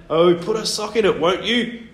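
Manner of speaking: high, loud grumbling bird voice; creaky grumble. A narrator's reading. Grumpy bird woken by a shouting boy, creaky grumble. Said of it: "grumbled a creaky voice behind him".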